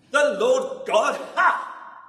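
mocking king's sneer with a sharp ha; snapped. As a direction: spit out the scoff in disbelief. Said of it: "snapped Ahab".

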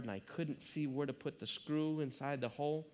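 ordinary talk, nothing marked

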